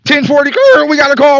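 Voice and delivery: high, loud hurried radio shout; a cop yelling a fake call to escape the driver; mock cop radio call. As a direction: bark it like a cop faking an emergency.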